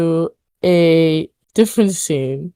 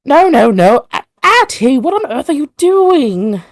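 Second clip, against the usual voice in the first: high, loud, scolding outburst; arguing woman; 9 semitones higher, 5 dB louder than usual. Acted. One of three unseen women objecting to the vision, arguing. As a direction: cry out in alarmed reproach, pitched up. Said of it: "these three women arguing".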